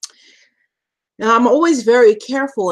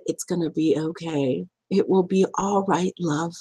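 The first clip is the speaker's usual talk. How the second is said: soft, reassuring social worker; quietly calm a scared child; gentle hush of comfort; soothing; 3 semitones lower, 6 dB quieter than usual. Retold or imitated. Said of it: "she said".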